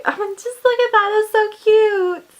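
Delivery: high, loud, fast gushing; excited fan gushing. Real, not acted. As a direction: squeal over how cute it is. Gushing over box back.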